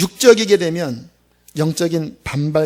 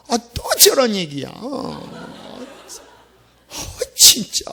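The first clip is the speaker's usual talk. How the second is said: annoyed grumble; 5 semitones higher, slower than usual. Retold or imitated. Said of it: "이게 막 속에서 반발심이 생겨요".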